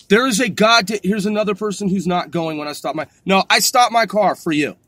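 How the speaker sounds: normal talking voice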